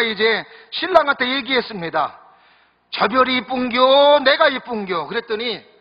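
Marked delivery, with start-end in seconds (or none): 2.9-5.1 Gyeongsang dialect bride, slow and a little higher; coy Gyeongsang country bride asking; 경상도 사투리 새색시 말투. Retold or imitated. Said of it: "경상도 분 용서하고 들으세요".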